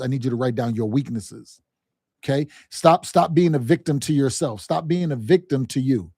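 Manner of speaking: normal talking voice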